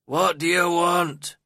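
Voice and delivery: loud, high, drawn-out growl; surly old servant calling out slowly; growled challenge. A narrator's reading. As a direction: growl it out at an unwelcome caller. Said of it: "he growled".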